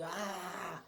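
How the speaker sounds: high drawn-out "aah"; imitated pained shout. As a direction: mimic a man crying out in pain. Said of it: "bending over and shouting".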